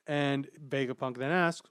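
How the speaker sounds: ordinary delivery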